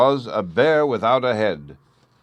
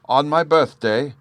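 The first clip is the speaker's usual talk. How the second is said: plain reading voice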